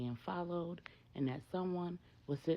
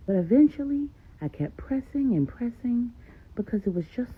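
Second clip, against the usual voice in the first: loud, insistent mother telling her story; much louder, emphatic mother recounting the past; Maria's voice; 13 dB louder than usual. Acted. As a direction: pour out the whole history, forceful and loud. Narrator voices Maria's long account.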